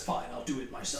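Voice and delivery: low, soft, weary resolve; a hint of a grim villain giving in; muttered Thanos quote; Thanos line. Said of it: "God pulls a Thanos and says".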